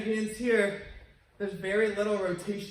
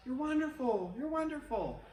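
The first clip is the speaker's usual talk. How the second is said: high, repeated and fading softer; fading echo; 6 semitones higher, 4 dB quieter than usual. Retold or imitated. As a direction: let it ring back and die. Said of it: "they heard back".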